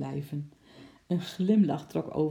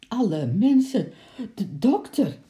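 higher, louder shocked outburst; shocked stammer; 7 semitones higher, 8 dB louder than usual. Acted. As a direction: blurt it out in fright. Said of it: "stamelde Bart geschrokken".

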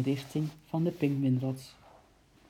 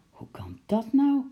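high, louder amazed question; astonished; 9 semitones higher, 5 dB louder than usual. A narrator's reading. Uncle hearing penguins escaped, loud amazed question. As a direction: ask it in full disbelief.

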